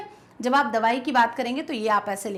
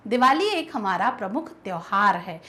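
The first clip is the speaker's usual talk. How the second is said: ordinary talk, nothing marked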